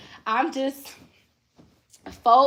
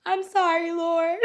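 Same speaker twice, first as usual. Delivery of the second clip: sheepish apology; 7 semitones higher than usual. Retold or imitated. Acting out her sheepish apology to God.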